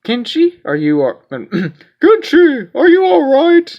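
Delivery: put-on high, loud old man voice; shaky elderly grandpa. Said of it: "that's my grandpa voice".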